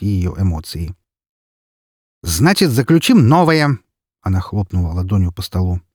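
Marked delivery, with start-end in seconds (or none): 2.2-3.8 angry. Acted. Yudina slams the table.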